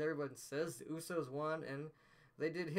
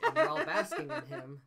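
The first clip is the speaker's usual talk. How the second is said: words bubbling over with a laugh, pitched up; 13 semitones higher, 10 dB louder than usual. Real, not acted. Cracking up at her own joke about Sheamus glowing, laughing. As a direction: laugh through the line as the joke lands.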